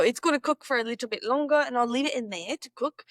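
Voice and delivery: wavering between British and Aussie; British-Australian accent. Acted. Vlogger reports on the oven, in a British-Australian accent. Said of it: "I'm going between a British and Australian accent".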